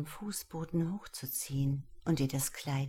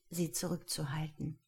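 ordinary delivery, nothing marked

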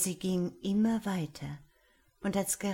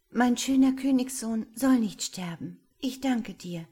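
higher, fervent angel vow; determined angel; 5 semitones higher, 7 dB louder than usual. Acted. Angel vows to save her prince, high and fervent. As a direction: swear it with feeling.

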